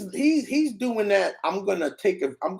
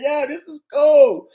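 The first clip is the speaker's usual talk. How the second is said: higher, louder "Yo, this is cool" with a grin; delighted; 7 semitones higher, 8 dB louder than usual. Real, not acted. Streamer replaying his clip for chat, loud and delighted. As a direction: hype it up for chat.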